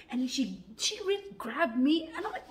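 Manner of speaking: ordinary delivery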